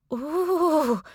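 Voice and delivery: high, shuddering gasp of an ooh. A narrator's reading. Frightened girl wincing at the punch, shuddering ooh. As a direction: wince at the blow, voice jumping up. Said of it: "the girl in the corner shuddered".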